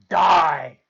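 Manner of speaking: emphatic, loud "die"; wicked fairy setting a deaf one straight; loud correction. A narrator's reading. Bibo corrects the deaf fairy loudly.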